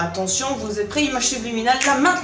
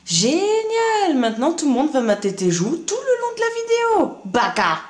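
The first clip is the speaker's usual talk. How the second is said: voix moqueuse; 6 semitones higher than usual. Acted. Second voice mocks his swollen cheeks.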